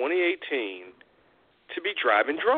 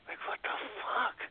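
disgusted aside; 9 semitones higher, 10 dB quieter than usual. Real, not acted. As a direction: recoil at it under your breath. Reacting to kiss cam.

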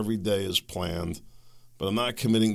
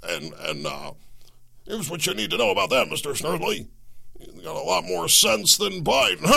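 Rush Limbaugh impression; 9 semitones higher than usual. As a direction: bluster like a pumped-up radio salesman. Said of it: "he would have started".

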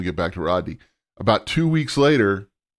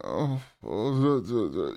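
flustered stammer; 7 dB quieter, slower than usual. Retold or imitated. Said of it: "and I went".

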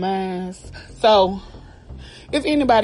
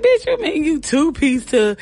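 laughing; 7 semitones higher than usual. Retold or imitated. Laughing disbelief at sister.

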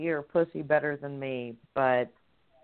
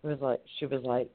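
ordinary delivery

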